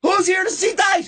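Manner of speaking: very high crowd-rousing holler; onstage hype call. Retold or imitated. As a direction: call out to the whole theater. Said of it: "I walk out, I go".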